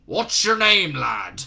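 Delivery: loud gruff demand; Shadwell's gruff voice. Acted. Shadwell questioning Newton, gruff and loud. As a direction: grill the lad. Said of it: "the voice the color of an old raincoat".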